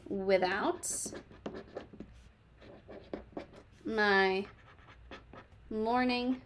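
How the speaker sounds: slow word-by-word dictation. Shown as a demonstration. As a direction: read it out for copying. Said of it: "I would say".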